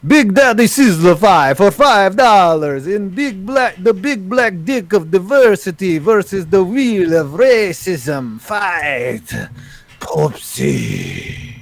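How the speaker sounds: put-on slow, heavy Russian accent. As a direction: put on a Russian voice and draw out the words. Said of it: "We gotta do a voice".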